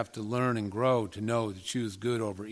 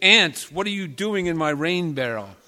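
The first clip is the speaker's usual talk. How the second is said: louder, higher acted scolding of an intruder; angry yell; 5 semitones higher, 9 dB louder than usual. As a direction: raise the voice in a storyteller's mock anger. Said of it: "yelled angrily".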